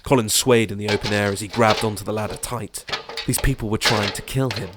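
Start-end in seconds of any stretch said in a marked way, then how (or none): none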